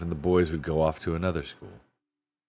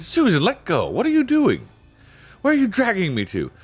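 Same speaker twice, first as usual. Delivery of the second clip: put-on alarmed boy; 11 semitones higher, 3 dB louder than usual. A narrator's reading. Peter suddenly being dragged away.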